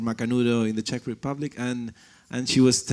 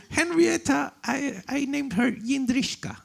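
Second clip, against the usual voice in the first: imitating the translator; 13 semitones higher than usual. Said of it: "I don't know if she talks like this".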